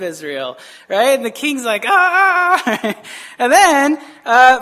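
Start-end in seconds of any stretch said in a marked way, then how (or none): 1.9-3.0 high, loud mock cry of frustration; comic frustrated "aah"; exasperated yell. King hearing yet another blessing, mock exasperated yell.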